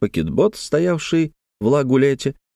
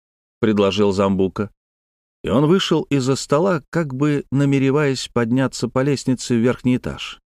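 ordinary delivery, nothing marked

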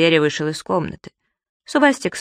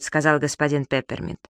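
usual speaking voice

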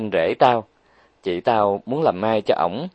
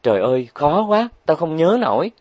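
whining; 7 semitones higher than usual. Acted. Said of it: "cô nàng đã bắt đầu rên rỉ".

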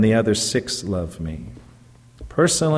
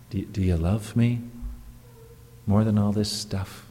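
soft question; 3 semitones lower, 6 dB quieter than usual. Acted. Jesus' question asked softly.

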